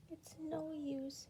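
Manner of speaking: soft, slow, sighing hopelessly. A narrator's reading. Miss Giggles giving up, sighing softly. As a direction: let it out on a tired sigh. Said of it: "she sighed".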